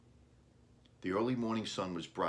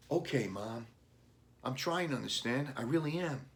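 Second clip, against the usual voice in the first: put-on lightly higher, earnest young boy; boy's voice; 6 semitones higher than usual. A narrator's reading. Boy assuring his mom he understands, lightly higher. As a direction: sound like a kid trying hard.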